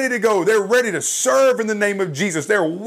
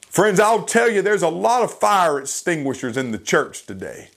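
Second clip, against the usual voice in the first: ordinary talk, nothing marked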